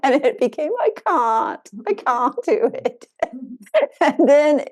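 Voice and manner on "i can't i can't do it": put-on "I can't" in a playful British boy's accent; lightly comic British accent, slightly raised. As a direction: put on a British boy, amused. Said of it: "the accent of a British boy".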